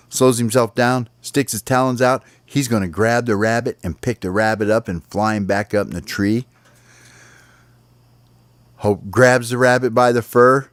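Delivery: usual speaking voice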